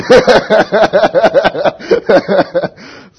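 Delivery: long, loud high-pitched laugh; real belly laugh at a funny story; laughter. Real, not acted. Laughs at his own story.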